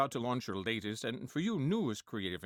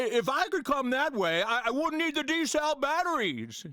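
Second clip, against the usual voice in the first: Jimmy Stewart impression; 7 semitones higher, 4 dB louder than usual. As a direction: stutter through it like Jimmy Stewart, pitched up. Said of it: "Let's listen to Jimmy Stewart already talking".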